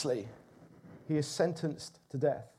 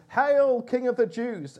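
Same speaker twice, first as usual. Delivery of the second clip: higher, louder mock salute; mocking taunt; 7 semitones higher, 6 dB louder than usual. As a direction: sneer it like a soldier jeering a fake king. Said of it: "in mockery and taunted".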